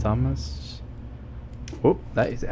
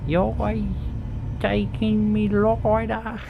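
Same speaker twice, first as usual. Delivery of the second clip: quoted voice; 10 semitones higher than usual. Retold or imitated. Quoting the same line, slower.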